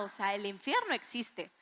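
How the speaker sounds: ordinary talk, nothing marked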